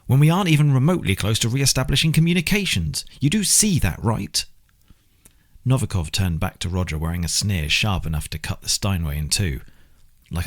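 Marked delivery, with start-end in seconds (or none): 0.1-4.5 louder, higher irritated snap; snapping. Acted. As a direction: bite the words off in frustration. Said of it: "he snapped".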